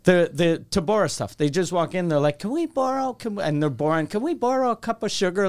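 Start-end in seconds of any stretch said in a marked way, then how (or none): 2.4-3.4 put-on higher-pitched little country kid; old-timey country kid. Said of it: "Like, very country, old-timey". 4.1-5.5 slightly put-on country kid, pitched up; old-timey country kid. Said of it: "Like, very country, old-timey".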